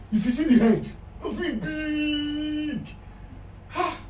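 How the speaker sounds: loud, high, last word drawn way out; heboh melebih-lebihkan. Acted. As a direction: marvel loudly at a monster rat. Exaggerating the rat's size.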